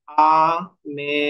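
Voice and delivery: "America" in four slow, drawn-out separate syllables. Shown as a demonstration. Teacher showing four steps in the word "America", slowly syllable by syllable. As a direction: stretch "America" as আ, মে, রি, কা. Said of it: "চারটা স্টেপে আমাকে উচ্চারণ করতে হবে".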